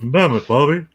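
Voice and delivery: Hank Hill catchphrase broken by laughter; laughing through a Hank Hill impression.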